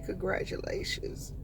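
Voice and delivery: soft unvoiced whisper. Real, not acted. Whispered blessing to the baby.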